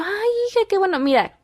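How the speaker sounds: high-pitched motherly praise; delighted mom. Retold or imitated. Mother cheers daughter's first R, delighted. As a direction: gush proudly over a child's success.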